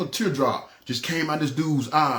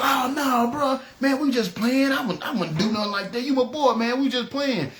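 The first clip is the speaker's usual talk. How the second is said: higher-pitched hurried denial; nervous backpedaling; 8 semitones higher than usual. Retold or imitated. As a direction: squeak out the excuse, caught red-handed. Robber backpedals, caught out.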